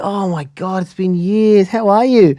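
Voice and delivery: high, excited retold greeting; delighted greeting. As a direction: gush like reuniting with an old neighbour. Old acquaintance delightedly greets him.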